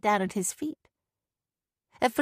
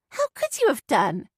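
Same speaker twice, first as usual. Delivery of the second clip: shooting back; 5 semitones higher than usual. Acted. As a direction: snap back defensively. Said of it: "Sadie shot back".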